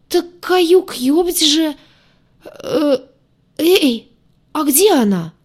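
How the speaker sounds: high, loud alarmed character voice. A narrator's reading. Crewmate wonders where she went, alarmed high voice. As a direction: blurt it out high, in rising panic.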